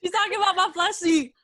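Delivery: high, loud laughing protest; real laughing. Real, not acted. Storyteller teases back at her scared friends, laughing. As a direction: blurt it out through laughter.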